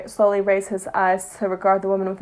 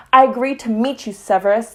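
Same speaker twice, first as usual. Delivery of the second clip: harsh; 6 dB louder than usual. A narrator's reading. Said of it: "the witch spoke harshly".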